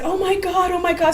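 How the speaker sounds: high, gushing oh my God; a teen meeting her idol; starstruck girl. Retold or imitated. The choir girl greeting the singer, thrilled and high. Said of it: "she said".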